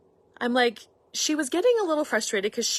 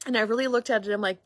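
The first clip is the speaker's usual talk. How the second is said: ordinary talk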